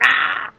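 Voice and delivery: high, loud spazzy scream; pretend excitement. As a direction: squeal with put-on excitement. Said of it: "pretending to be way more excited".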